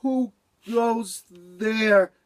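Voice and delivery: very slow drowsy call; sleepy voice. Acted. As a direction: mumble it just woken up. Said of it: "Beaver said in a sleepy voice".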